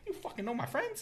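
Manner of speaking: shocked she knows them; a laughing incredulous question; disbelief. Retold or imitated.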